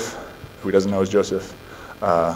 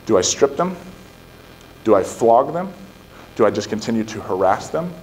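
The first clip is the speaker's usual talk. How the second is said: ordinary delivery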